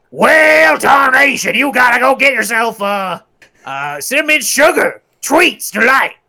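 Yosemite Sam. Pretend. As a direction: yell it like a hotheaded cartoon outlaw. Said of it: "I wanna hear your Yosemite Sam".

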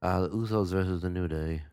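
lightly put-on weird actor accent; a hint of a screen-legend impression; badly faked, odd movie-star voice; Marlon Brando. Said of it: "had this weird accent".

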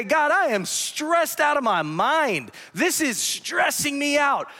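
high, slow, anguished cry to God; stressed-out prayer. Retold or imitated. Pastor reenacting his anxious prayer, crying out. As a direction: pour out the stress, calling up high. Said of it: "if I go to God and say".

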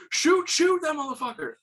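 high, excited command; frantic urging. Retold or imitated. Dad telling kid to shoot the buck, imitated frantic urging. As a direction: imitate a panicked hunter urging a shot.

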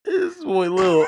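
slow, high, laughing yell; silly drawn-out shout. Pretend. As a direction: stretch it out in a goofy shout. Goofy outro sign-off.

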